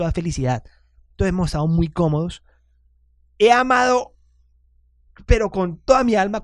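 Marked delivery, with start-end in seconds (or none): 3.4-6.4 gushing confession. Real, not acted. Confessing his Amazon shopping love.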